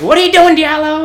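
high, loud drill-chief bark; loud mock chief demanding an answer; mock chief yell. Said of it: "our chief is like".

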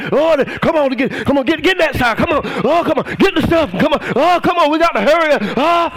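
mock someone frantically rushing around; hammed-up hurrying worshipper; rushed busy churchgoer. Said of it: "You try".

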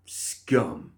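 contemptuous, spat-out single word; spat insult. A narrator's reading. Guard repeating his insult to Molly, spitting it. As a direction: spit it out with contempt. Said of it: "the guard spat".

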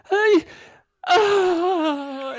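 very high, drawn-out panicked wail; imitated loud shriek. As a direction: mimic a shuddering, screaming skydiver. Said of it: "it was so loud".

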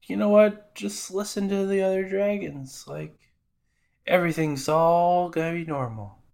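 Luffy reassuring Apis in a high, wimpy voice. Retold or imitated. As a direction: squeak out a pep talk like a timid hero. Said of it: "I'm not doing my Luffy Australian accent".

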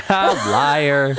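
laughing as she speaks. Real, not acted. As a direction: call out a lie through a laugh. Laughing at friend's excuse.

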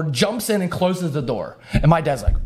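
ordinary talk, nothing marked

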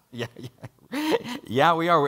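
sheepish laughing yes; laughing admission. Retold or imitated. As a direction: laugh through it, embarrassed to admit it. Admitting being lost, laughing.

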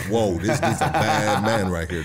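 slow, amazed line broken by laughter; a chuckling, wide-eyed retold crowd; laughing awe. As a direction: marvel at him while laughing. Crowd impressed by his moves.

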